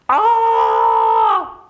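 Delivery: high, loud startled scream. Acted. Man finds his clothes gone, screams out in shock. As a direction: shriek in sudden shock. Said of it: "and looked around and screamed".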